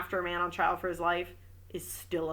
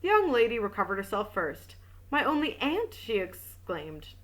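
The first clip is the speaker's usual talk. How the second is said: even audiobook reading voice; story narration; 3 semitones higher than usual. A narrator's reading. Reading a detective novel aloud, steady narration. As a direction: read the novel aloud to listeners.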